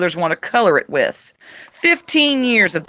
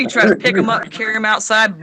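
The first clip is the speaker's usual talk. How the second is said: usual speaking voice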